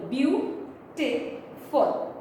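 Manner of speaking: beautiful said very slowly, each syllable apart. Shown as a demonstration. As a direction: stretch out beautiful to count its syllables. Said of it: "three syllables".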